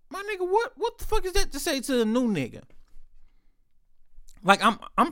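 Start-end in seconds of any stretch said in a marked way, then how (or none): 0.1-2.6 pitched way up in disbelief; high, stunned what the hell; incredulous. Retold or imitated. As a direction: react like you can't believe he said that. Retold own disbelief at coworker.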